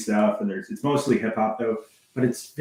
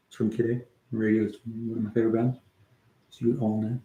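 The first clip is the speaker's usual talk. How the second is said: ordinary delivery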